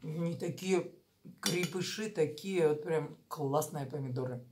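eating between words; talking while chewing. Real, not acted. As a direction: mouth partly full of food. Seen 1: ate greens before, spoonful of mince at 4.7s, chewing throughout.

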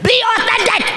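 squeaky high mock exclamation; mock self-scolding. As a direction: scold yourself, high and playful.

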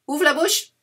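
high, loud command; loud trick call. Acted. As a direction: try to catch them out.

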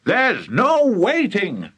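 high, slow, emphatic exclamation; overexcited father brushing off objections; excited cry. Acted. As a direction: cry it out, stretching each word. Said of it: "cried Mister Cranky".

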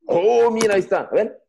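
ordinary talk, nothing marked

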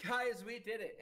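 high, loud triumphant cheer to the party; joyful cry. Acted. Priestess cheering to the heroes about the victory, high and loud. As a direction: shout the win out to everyone. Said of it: "smiles and says".

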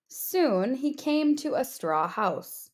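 ordinary delivery

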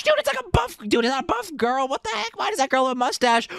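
high-pitched disbelief; shocked surprise. Real, not acted. Gamer spotting a mustached buff girl, shocked. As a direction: squeal questions in astonishment.

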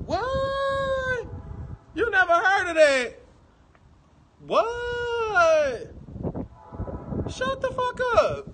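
very high, drawn-out incredulous squeal; high, over-the-top shocked outburst; shrieked disbelief. Real, not acted. Disbelief at what the chat says.

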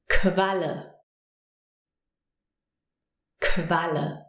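"Qualle" begun with k plus v; German QU as kv. Shown as a demonstration. Teacher giving an example word for German Qu, model pronunciation. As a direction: say "Qualle" as k-valle, carefully on its own. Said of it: "we pronounce it".

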